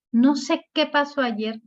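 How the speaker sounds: respuesta más aguda, con peso en «qué»; pregunta indirecta. Shown as a demonstration. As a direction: contesta marcando «qué», en tono alto. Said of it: "cuando yo no sé qué responder, digo". (respuesta indirecta, aguda)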